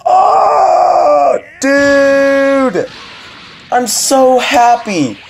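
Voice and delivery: high, loud, slow happy shout; tearful happy shout; overjoyed shout. Retold or imitated. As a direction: cry out with joy. Reliving overwhelming happiness.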